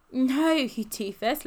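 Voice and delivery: mock fondly with a touch of laughter; amused. A narrator's reading. Ben correcting a friend's silly mistake, amused. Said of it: "laughed Ben".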